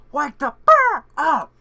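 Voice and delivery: yelling wake up at full blast; shouting. Pretend. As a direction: scream at her to wake up. Yelling at sleeping Miku.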